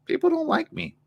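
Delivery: put-on small, high child voice; soft and high-pitched, like a hurt little kid; eight-year-old self. Speaker voicing their insecure eight-year-old self, small childlike voice. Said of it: "go back to my eight-year-old self".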